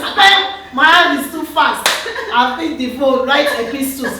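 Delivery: teasing husband. Retold or imitated. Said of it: "My husband says".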